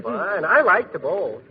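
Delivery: ordinary delivery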